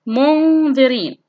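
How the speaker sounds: very slow منذرين, noon held as a hum; ikhfa demonstration. Shown as a demonstration. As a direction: stretch the nasal before ذ in منذرين. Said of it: "انظر معي". (إخفاء النون بغنّة ممدودة في منذرين)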